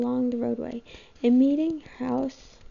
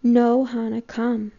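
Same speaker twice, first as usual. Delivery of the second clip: loud, urgent plea; urgent call; 8 dB louder, slower than usual. A narrator's reading. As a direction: urge her on, loudly. Pulling Hannah away urgently.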